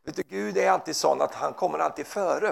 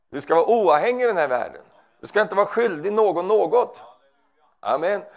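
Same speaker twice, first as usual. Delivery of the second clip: predikar för fullt; 6 semitones higher, 4 dB louder than usual. Acted. Preaching at full force.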